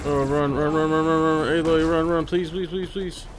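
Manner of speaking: high, loud frantic pleading; urging her to flee, real panic; being chased. Real, not acted. Character fleeing enemy in game.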